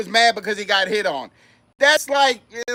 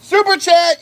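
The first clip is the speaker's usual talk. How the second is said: excited; 6 semitones higher, 4 dB louder, slower than usual. Acted. Echoes call.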